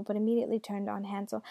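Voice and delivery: ordinary delivery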